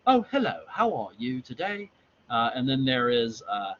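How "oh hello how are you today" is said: high, swooping proper British greeting; exaggerated polite proper British; proper British accent. Shown as a demonstration. Reader showing proper British against Cockney, polite high greeting. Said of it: "there's the proper British".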